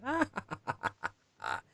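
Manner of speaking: high mocking ha-ha that falls away; mocking devil laugh. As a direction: laugh at him like a smug devil.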